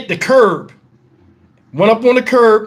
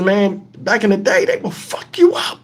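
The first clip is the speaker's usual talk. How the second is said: normal talking voice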